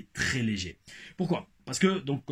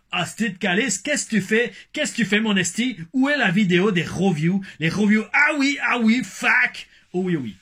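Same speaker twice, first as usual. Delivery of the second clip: loud, high Québécois rant; yelling at Crasby in a thick Québécois accent; 8 semitones higher, 8 dB louder than usual. Pretend. Vlogger ribbing Crasby for the late video, loud Québécois accent. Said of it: "refaire mon accent québécois".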